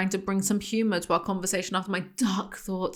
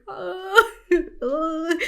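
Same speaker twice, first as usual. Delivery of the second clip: high, strained laughing "ah"s; mock moaning cries; 10 semitones higher than usual. Host imitating Anna's cries while laughing. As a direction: squeal it out, half-laughing. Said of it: "just like".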